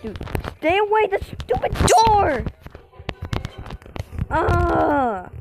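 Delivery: being chased. Real, not acted. Being chased, yelling at cat.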